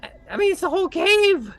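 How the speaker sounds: high loud squeaky begging and flattery; grovelling kobold. Acted. Kobold flattering his captor, squeaky and desperate. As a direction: fawn desperately in a tiny high voice.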